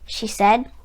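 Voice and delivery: ordinary delivery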